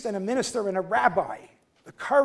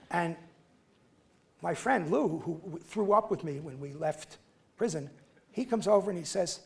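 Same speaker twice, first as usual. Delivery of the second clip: normal talking voice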